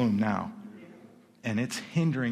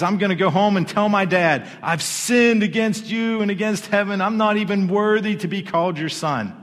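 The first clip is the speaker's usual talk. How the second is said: high, loud over-the-top confession; serious groveling; 6 semitones higher, 7 dB louder than usual. Acted. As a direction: grovel loudly, pitched up, as a rehearsed apology. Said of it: "That's some serious groveling going on".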